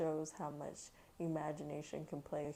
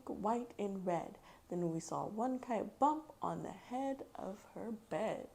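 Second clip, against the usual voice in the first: put-on lively, louder read-aloud voice for kids; story reading aloud; 6 semitones higher, 5 dB louder than usual. A narrator's reading. Mom reading Dr. Seuss to her kids, lively read-aloud. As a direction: read the rhyme to a child with bounce.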